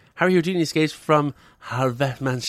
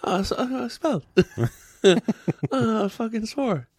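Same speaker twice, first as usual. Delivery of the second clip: higher, sheepish, a bit fast; mock sheepish John McEnroe; 6 semitones higher than usual. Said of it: "John, why".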